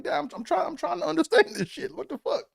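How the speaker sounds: very high squeaky protest; whiny kid pleading. Retold or imitated. Son protesting to dad over math, high squeak.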